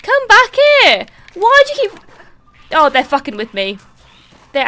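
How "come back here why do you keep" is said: high, loud yell after someone fleeing; exasperated shout. Real, not acted. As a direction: shout after them in frustration. Shouting at fleeing game enemies.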